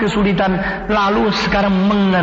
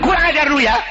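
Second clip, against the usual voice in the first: indignant scolding; 9 semitones higher than usual. Acted. Angry nurse scolds junior.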